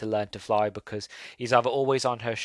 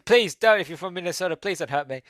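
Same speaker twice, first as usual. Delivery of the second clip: hurried, high-pitched mock plea; mock pleading; 6 semitones higher, 4 dB louder than usual. Reviewer to offended Minnesota viewers, playfully pleading. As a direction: beg for mercy in jest.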